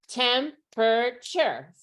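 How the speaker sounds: "Temperature" slowly in three beats, tem-per-chur; syllable by syllable. Shown as a demonstration. Teacher confirming the count of three, syllable-by-syllable "Temperature". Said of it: "can you stomp the syllables".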